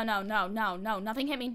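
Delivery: usual speaking voice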